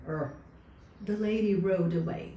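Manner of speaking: ordinary delivery, nothing marked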